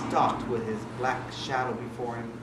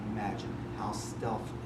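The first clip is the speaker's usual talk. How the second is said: whispering; 5 semitones lower, 8 dB quieter than usual. A narrator's reading. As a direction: hush it like a secret. Stealthy narration.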